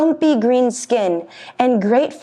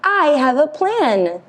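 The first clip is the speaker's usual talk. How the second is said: smug little goat; 7 semitones higher than usual. Acted. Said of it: "feeling very smart indeed".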